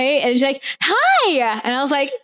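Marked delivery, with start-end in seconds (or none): 0.8-1.6 high-pitched, mock perky hello; imitated eager greeting. Girl waves at me like she knows me, imitated eager high greeting. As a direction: imitate an over-keen stranger, pitched high.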